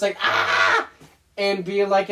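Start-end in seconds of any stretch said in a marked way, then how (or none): 0.2-0.9 short, screechy witch "Ah!"; witch-like cry. Shown as a demonstration. Demonstrating the voice from an old video, witch-like cry. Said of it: "witch-like voice".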